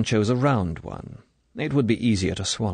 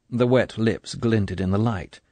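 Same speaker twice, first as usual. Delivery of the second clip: usual speaking voice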